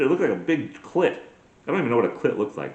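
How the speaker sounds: ordinary talk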